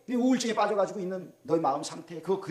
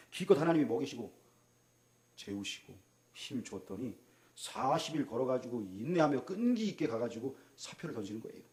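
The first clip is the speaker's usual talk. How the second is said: ordinary talk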